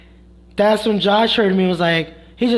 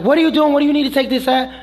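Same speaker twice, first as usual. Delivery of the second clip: high, louder imitation of a demanding coworker; raised-voice retold outburst; mock yelling; 7 semitones higher, 3 dB louder, faster than usual. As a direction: fire off questions in a raised mock yell.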